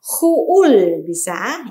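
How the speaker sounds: Huul stretched out very slowly; over enunciating. Shown as a demonstration. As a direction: drag out the vowel in Huul. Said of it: "stretched repeat".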